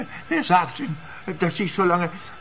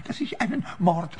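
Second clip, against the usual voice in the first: usual speaking voice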